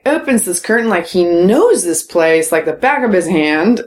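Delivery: usual speaking voice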